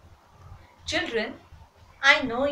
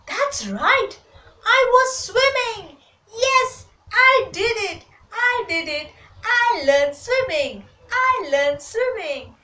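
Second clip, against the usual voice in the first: high, loud, jubilant exclaiming; triumphant excitement; 10 semitones higher, 6 dB louder than usual. A narrator's reading. Duck finds it can swim, loudly jubilant. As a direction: celebrate like a proud little duck. Duck rejoices at having swum.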